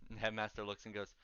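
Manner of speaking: ordinary talk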